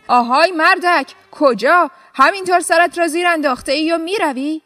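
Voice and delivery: shouting governor. A narrator's reading. Governor shouts after the farmer walking out, loud. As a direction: call him back sharply across the room. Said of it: "داد زد".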